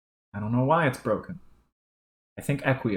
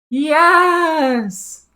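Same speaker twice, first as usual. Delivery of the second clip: much higher, loud shout; triumphant yes; 10 dB louder than usual. Acted. Troll cheering the agreement, loud and high.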